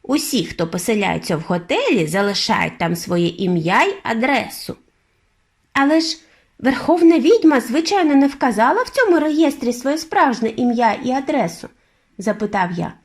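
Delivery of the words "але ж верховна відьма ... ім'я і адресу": put-on young boy's voice, pitched higher and a little louder; eager boy. A narrator's reading. Boy asking his grandmother about the witch, eager and high. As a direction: ask like a curious little boy.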